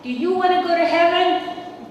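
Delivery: big booming call to the crowd, high and loud; imitating her husband thundering at the people; echoing his booming voice over a crowd; booming preacher voice. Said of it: "he had this booming voice".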